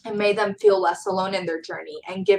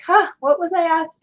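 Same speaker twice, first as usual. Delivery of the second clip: higher, louder puzzled self-quote; mock puzzlement; 9 semitones higher, 6 dB louder than usual. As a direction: act out drawing a blank, playfully. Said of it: "'cause I'm like".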